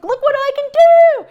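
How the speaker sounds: very high, loud cry of delight; excited father. Retold or imitated. Father showing his daughter his new freedom, a very high joyful exclamation. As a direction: exclaim it like a thrilled kid showing off.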